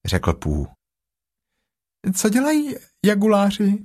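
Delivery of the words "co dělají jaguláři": very high, small piglet voice; high-pitched little animal. Acted. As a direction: squeak it out like a tiny piglet. Piglet asks about jaguars.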